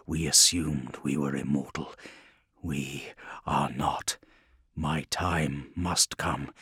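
low, quiet, slow inner voice; brooding softly to himself; inner thoughts. Acted. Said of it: "thought Guilliman".

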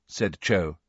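plain reading voice

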